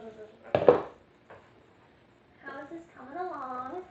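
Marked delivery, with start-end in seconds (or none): 0.0-0.9 mouth full of hot food. Real, not acted.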